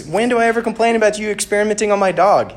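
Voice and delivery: high, loud, fast indignant rant; acted exasperation at full tilt; bickering. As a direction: fire off an outraged complaint. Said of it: "they're bickering with each other".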